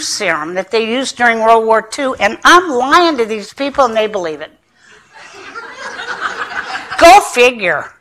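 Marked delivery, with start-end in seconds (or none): 5.5-6.9 소리 없이 숨으로 터지는 웃음; 어이없어 숨죽여 웃기; breathy silent laugh. Real, not acted. Laughs at her own lying.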